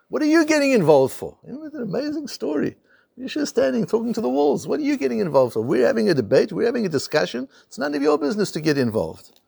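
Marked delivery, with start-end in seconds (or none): 0.1-1.4 high, loud scolding at the walls; scolding shout. Retold or imitated. Rabbi Yeshua scolding the collapsing walls, high scolding shout. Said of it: "he shouted at them". 4.7-9.4 higher, mild scolding voice; retold scolding. Rabbi Yeshua telling the walls to stay out, mild high scolding. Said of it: "he shouted at them".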